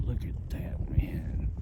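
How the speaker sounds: hushed whisper at the view; softly whispered wonder; awed whisper. Real, not acted. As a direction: breathe it out in awe.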